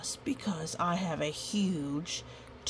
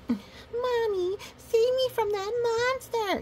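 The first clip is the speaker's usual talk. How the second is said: very high, louder cry for mommy; mock-scared kid shrieking for mommy; hollering child; 4 dB louder, slower than usual. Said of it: "you holler".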